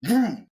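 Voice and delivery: high, punchy vroom; playful car sound effect; imitated engine vroom. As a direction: rev it up like a car taking off.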